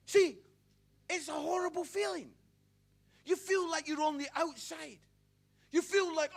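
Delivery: loud, driving preacher voice; preaching at full force. Acted. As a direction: preach it hard to the crowd.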